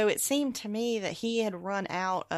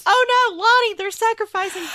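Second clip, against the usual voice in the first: much higher and louder, hammed-up panic; playful fake horror at the goats; mock alarm; 13 semitones higher, 11 dB louder than usual. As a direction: squeal it like a cartoon panic. Mock alarm at the sacrifice scene.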